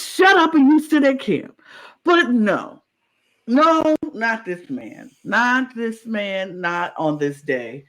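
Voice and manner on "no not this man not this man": slow, stretched not this man; emphatic no. Real, not acted. Fan mocking the player's choice, slow emphatic drawl. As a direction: drag it out in disbelief. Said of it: "emphatic disbelief".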